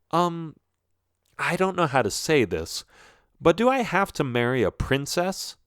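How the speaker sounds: a hint of a hesitant son; lightly voiced hesitant prince. Prince asks his father about marrying, hesitant.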